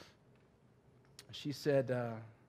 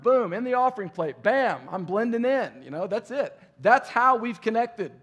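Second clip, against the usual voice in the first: excited retelling; 8 semitones higher, 11 dB louder than usual. Retold or imitated. Recounting Melissa's church routine.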